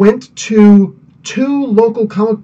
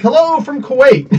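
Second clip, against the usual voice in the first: mock cheery greeting, pitched higher; imitating a chatter; 8 semitones higher than usual. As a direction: play the viewer's cheerful hello, lightly. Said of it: "He used to be like".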